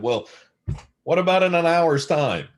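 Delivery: imitating an eager interviewer springing the offer; eager retold offer. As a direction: pitch it up like a keen host jumping in. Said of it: "He said".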